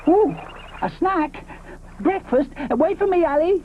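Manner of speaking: high excited voice; eager Moschops. Acted. Moschops hears of a snack, high eager voice. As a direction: jump at the idea.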